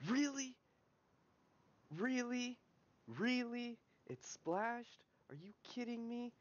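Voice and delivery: high, outraged disbelief; mad, incredulous. Real, not acted. Gamer after a splashed spell, mad and incredulous. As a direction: squeal each question in indignant disbelief. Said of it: "I'm actually mad".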